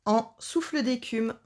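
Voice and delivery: ordinary talk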